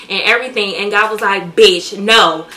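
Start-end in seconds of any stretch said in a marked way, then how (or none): 1.5-2.5 loud, blunt mock reprimand; scolding God. God shutting down the vlogger's celebrating, mock scolding. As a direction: put her firmly in her place.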